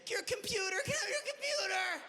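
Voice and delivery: mock child begging a parent for a phone; begging toddler.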